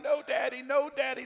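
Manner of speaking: high, loud mock child's wail; imitated crying children. Scared kids crying to Daddy, imitated wail. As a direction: imitate little ones crying out in protest. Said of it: "they're crying".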